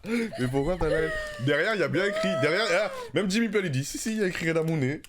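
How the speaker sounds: high, loud and laughing; insisting through laughter; excited laughing protest. Real, not acted. Laughing protest about label.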